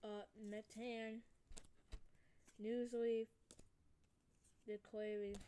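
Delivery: ordinary talk, nothing marked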